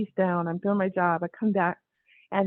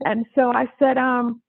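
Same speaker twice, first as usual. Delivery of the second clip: usual speaking voice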